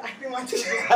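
laughing through the words. Retold or imitated. Telling friends her tooth fell, laughing. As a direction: giggle it out. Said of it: "came out laughing and said".

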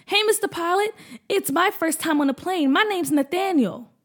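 put-on high, eager child voice; little boy voice. Boy greets the pilot on his first flight, high and eager. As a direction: sound like an excited little boy.

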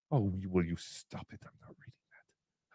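hushed refusal; whispered under breath. Real, not acted.

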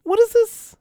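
high bewildered "What is this?"; baffled. Pretend. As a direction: sound totally confused. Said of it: "be like".

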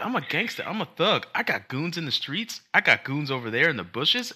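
put-on high, tough-kid brag; mock kid gangster. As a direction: play a little girl acting tough. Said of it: "And she's like".

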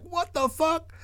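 tiny, very high squeak; imitated high, hushed bewilderment; mock stunned disbelief. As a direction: squeak it out softly in shock. Onlookers react in shock to fire.

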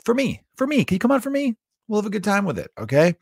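high, wheedling mock begging; playful pleading. Comedian coaxing reluctant fans to his New York show, mock pleading.

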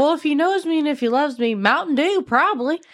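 imitating Shia. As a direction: pitch it up like a lovestruck girlfriend. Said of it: "And she goes".

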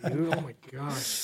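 ordinary talk

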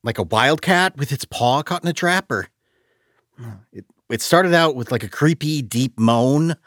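high, loud voice, stumbling over the description; shaken, groping account. A narrator's reading. Exley tells the shadowy figure of the sound, shaken.

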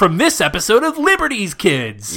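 mock promo voiceover; show announcer. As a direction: tease the scenes like an excited announcer. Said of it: "a voice goes".

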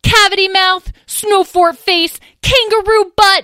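very high, loud screaming insults; furious shouting. Acted. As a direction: scream and roar through the brawl. Fighting, insults.

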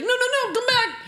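very high, urgent squeal; mock pleading. Woman chasing a kiss as he pulls away, squealed mock plea. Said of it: "Like, she's like".

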